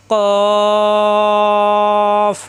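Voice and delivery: কফ chanted long from the back of the tongue; recited letter. Shown as a demonstration. Teacher modelling the letter for the class, chanted. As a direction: stretch কফ out, recitation style. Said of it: "জিহ্বার গোড়া থেকে".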